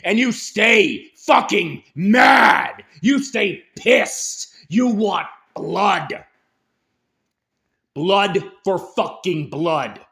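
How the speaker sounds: slow, loud, drawn-out yelling; furious shout. Real, not acted. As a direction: bellow each word slowly with fury. Ranting call to rage.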